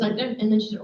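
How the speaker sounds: usual speaking voice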